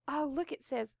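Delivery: usual speaking voice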